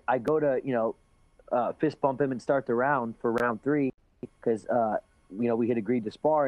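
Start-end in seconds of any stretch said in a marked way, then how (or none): none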